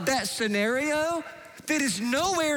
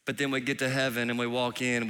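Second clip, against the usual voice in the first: ordinary delivery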